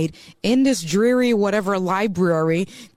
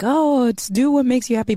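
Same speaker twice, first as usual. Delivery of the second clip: lightly mocking a cheesy cliché, a bit higher; mock generic advice; 6 semitones higher, 3 dB louder than usual. Guest mocks generic advice to podcast host, lightly sarcastic. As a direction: toss off the stock advice with a touch of irony. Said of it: "Like, it sounds so generic".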